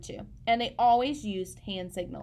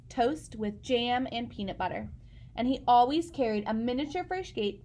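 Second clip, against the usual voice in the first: plain reading voice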